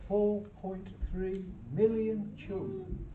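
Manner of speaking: ordinary delivery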